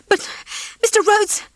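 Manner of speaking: high, tearful plea; desperate hen pleading through tears; begging. Acted. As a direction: beg him with a crack of tears in the voice. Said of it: "she begged".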